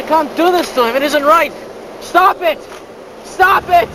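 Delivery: urgent shout to stop. Acted. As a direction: yell it out, pleading. Prisoner protests beating.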